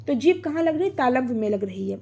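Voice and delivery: ordinary talk, nothing marked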